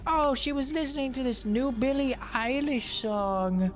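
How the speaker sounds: put-on high prim old-lady voice, shocked; mimicking mom. Son mimics mom's horror at sister's music, high and prim. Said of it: "And she's like".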